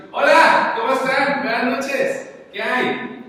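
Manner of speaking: hammed-up boisterous arrival at a wake; loud party greeting. Shown as a demonstration. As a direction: barge in greeting everyone at the top of your voice. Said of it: "no habla así".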